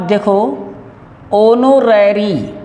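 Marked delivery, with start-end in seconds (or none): 1.3-2.5 ओनोरेरी said slowly starting on o; silent h. Shown as a demonstration. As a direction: stretch out ओनोरेरी, no h. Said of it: "ओ बोला जा रहा है". (धीरे से ओनोरेरी, ओ से शुरू)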